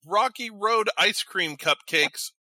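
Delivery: usual speaking voice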